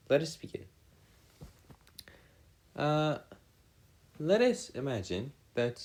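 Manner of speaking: normal talking voice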